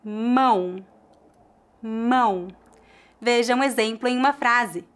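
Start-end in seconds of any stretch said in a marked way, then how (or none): 0.0-2.5 Mão with a nasal ão diphthong. Shown as a demonstration. Teacher models Mão for learners, nasal diphthong. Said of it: "feita com o ditongo nasal".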